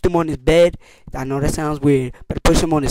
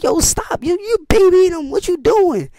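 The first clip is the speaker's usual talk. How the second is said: much higher, annoyed telling-off; exasperated scolding; 13 semitones higher, slower than usual. Retold or imitated. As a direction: stop her babying them. Telling her to stop babying.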